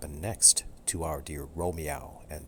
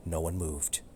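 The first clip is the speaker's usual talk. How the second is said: plain reading voice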